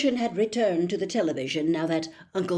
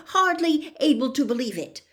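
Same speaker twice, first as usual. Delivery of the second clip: ordinary delivery, nothing marked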